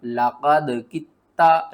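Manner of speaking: कित्ता with a doubled t, said slowly and loud; slow, firm demonstration; correct reading. Shown as a demonstration. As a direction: stress the doubled t in लक़द कित्ता. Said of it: "लक़द किद्ता आपने नहीं पढ़ना".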